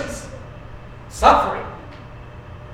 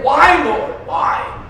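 pleading why; 6 semitones higher, much slower than usual. Retold or imitated. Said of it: "I'm saying".

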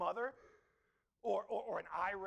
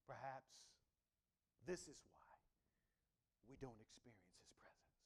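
a soft, breathy aside to the congregation; hushed whisper; 12 semitones lower, 15 dB quieter than usual. Real, not acted. Preacher whispering a searching thought to the church. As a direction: whisper it, almost to yourself.

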